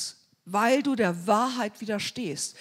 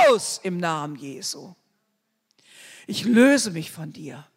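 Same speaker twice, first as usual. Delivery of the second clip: commanding deliverance prayer, forceful; preacher casting out fear in prayer; binding prayer; 5 dB louder than usual. Acted. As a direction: rebuke the spirit of fear directly.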